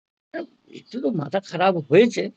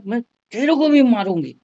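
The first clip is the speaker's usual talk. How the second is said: threat; 6 semitones higher, 6 dB louder than usual. Retold or imitated. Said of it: "दौड़ के कैसे बोला".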